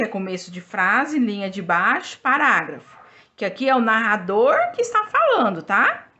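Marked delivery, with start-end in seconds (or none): none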